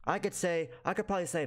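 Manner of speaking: usual speaking voice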